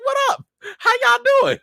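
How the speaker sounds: high, loud, breezy mock hello; casual Sonya greeting. Vlogger jokes Sonya greets Skrulls like old friends, breezy. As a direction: toss off a carefree street-style hello. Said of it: "she was just like".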